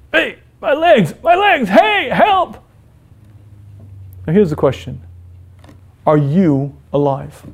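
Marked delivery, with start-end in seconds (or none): 0.1-2.6 high, frantic calls for help; panicked cry for help. Pretend. Patient discovers paralysis, cries out.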